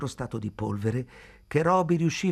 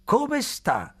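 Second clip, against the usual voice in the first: put-on high-pitched old man's greeting; toothless whistling greeting; 13 semitones higher, 3 dB louder than usual. A narrator's reading. Old man greeting May in English, high and whistling. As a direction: pitch it high, as through gaps in the teeth. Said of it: "con un sibilo della lingua fra i buchi".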